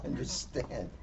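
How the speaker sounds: ordinary delivery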